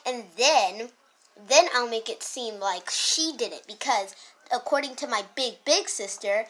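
ordinary talk

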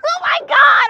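shrieking with joy, high and loud; excited shriek. Real, not acted. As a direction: squeal it out, thrilled mom. Daughter just passed driving test.

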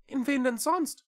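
high, rising small cat voice; questioning little cat. A narrator's reading. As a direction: ask back in a high little voice. Cat asks back. (hohe Katerstimme, nachfragend)

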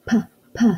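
P, p as a short popped p sound; teacher sounding out the letter. Shown as a demonstration. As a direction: isolate the p sound.

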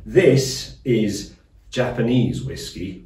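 ordinary talk

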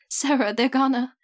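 loud, high panicked outburst; panicked shout. Acted. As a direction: blurt it out in panic. Nikta panicked, pinned to wall.

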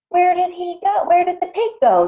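high, urgent questioning; animals crying out. A narrator's reading. Animals find the pig gone, high urgent cries. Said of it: "the animals cried".

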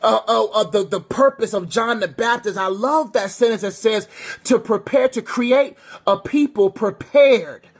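fervent preacher building intensity; preaching. Acted. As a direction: preach it out to the congregation.

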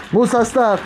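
firm shout. Real, not acted. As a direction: yell at the dog to leave it. Stopping the dog.

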